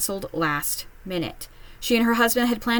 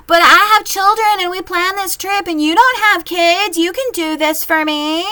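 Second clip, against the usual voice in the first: much higher, louder whiny demand; mocking entitled parent; 10 semitones higher, 8 dB louder than usual. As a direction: whine it like a parent who expects help.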